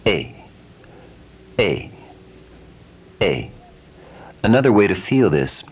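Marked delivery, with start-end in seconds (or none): 0.1-3.5 A as a blend of A and E; blended vowel A. Shown as a demonstration. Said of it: "a combination of the two".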